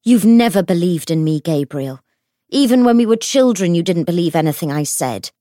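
loud, bitter accusation; angry outburst, pushed loud; enraged. A narrator's reading. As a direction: hurl the reproach loudly at your brother. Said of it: "face twisted with rage".

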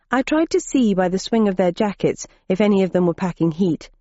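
usual speaking voice

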